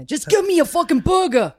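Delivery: loud, high, angry mock New Yorker; New York accent. Host shows guest a hammed-up New York accent. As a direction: demand it like an impatient New Yorker. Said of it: "doing a New York accent".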